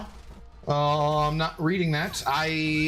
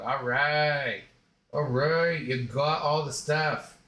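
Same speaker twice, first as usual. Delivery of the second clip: ordinary talk, nothing marked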